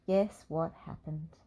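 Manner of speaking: ordinary delivery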